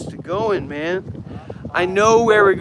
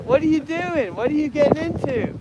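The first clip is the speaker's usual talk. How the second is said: put-on Aussie accent, pitched higher; chatty fake Australian; playful mock Australian accent; 3 semitones higher than usual. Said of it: "I like the Australian kind of shit".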